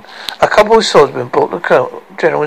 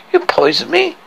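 high-pitched, indignant question; fear mixed with anger. Acted. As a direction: ask it frightened and angry. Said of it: "fear and anger mingling in her voice".

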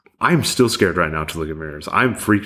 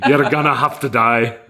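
laughing; 5 semitones higher than usual. Pretend. Farmer tells pony it must die.

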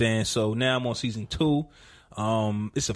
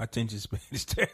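softer, faster, laughing through it; laughing admission; 2 semitones lower, 6 dB quieter, faster than usual. Real, not acted. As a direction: giggle out the confession.

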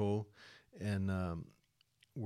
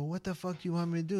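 exasperated outburst; 9 semitones higher, much faster than usual. Retold or imitated. Exasperated retort to wife.

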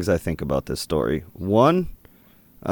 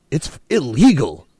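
imitating a stern, stiff zookeeper, a bit higher and louder; deadly serious zookeeper; 6 semitones higher, 4 dB louder than usual. Retelling the zookeeper's answer, a mock serious stern lecture. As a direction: lay down the rule with mock gravity. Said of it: "He gets so fucking serious".

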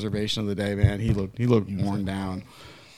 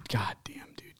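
whisper; 3 semitones lower, 15 dB quieter, slower than usual. Real, not acted. Awed reaction to story.

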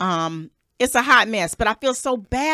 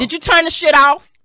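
louder and higher, a sharp demand; angry demand; 7 semitones higher, 7 dB louder than usual. Real, not acted. Host to co-host about his phone, angry and loud. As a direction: snap it at him, fed up.

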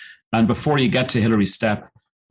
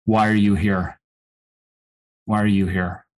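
inner voice. Retold or imitated. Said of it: "the voice basically said".

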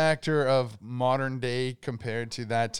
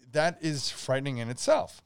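ordinary talk

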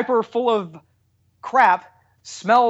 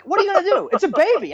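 high-pitched, helpless protest; mock exasperation with the voice pitched up; exasperated parent; 11 semitones higher, faster than usual. Parent answering jerks who stare at the baby, exasperated.

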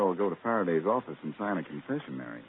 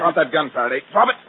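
loud, sharp order to drop the gun; commanding shout; 7 semitones higher, 7 dB louder than usual. Acted. Blackie orders the inspector to drop his gun, shouting. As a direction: bark it out, high and loud.

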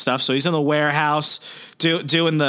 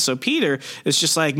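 ordinary delivery

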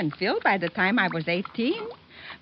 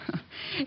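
soft breathy chuckle; 12 dB quieter than usual. Acted. Mother laughs at being fussed over.

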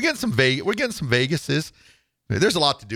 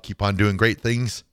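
ordinary talk, nothing marked

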